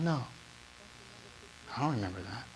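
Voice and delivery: hushed tender answer; gentle voice of God. Acted. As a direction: let it go quietly and kindly.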